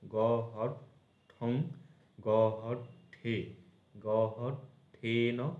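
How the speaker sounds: গ, হট, then ঠ with its ending, pauses between; measured drill, breaking before ঠো, ঠা, ঠং; syllable by syllable. Shown as a demonstration. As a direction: chop each form into গ – হট – ending. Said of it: "এই ঠ এর ওপর দিয়ে সব কিছু হবে".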